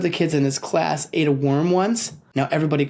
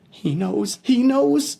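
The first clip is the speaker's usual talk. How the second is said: growing panic; 10 semitones higher, slower than usual. Acted. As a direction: realise in horror. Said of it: "Peter thought".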